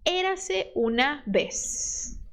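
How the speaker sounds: "Érase una vez" slow, with a plain S at the end of "vez"; slow American s. Shown as a demonstration. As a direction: say "vez" with an S sound, not a lisp. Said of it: "você vai fazer o som de S".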